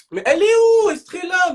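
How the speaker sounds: frantic high call for Estrella; high shout. Real, not acted. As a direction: yell out where is she. Shouting for missing girl.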